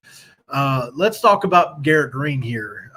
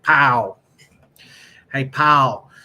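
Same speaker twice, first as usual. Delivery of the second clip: a louder, drawled country-dad "Paul"; thick country accent; 6 dB louder, much slower than usual. Retold or imitated. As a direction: call out like a rural dad. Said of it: "my dad had a real thick country accent".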